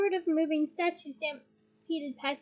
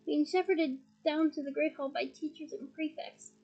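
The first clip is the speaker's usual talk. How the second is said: usual speaking voice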